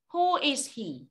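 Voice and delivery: normal talking voice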